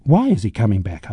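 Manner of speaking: ordinary delivery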